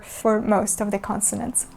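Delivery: ordinary talk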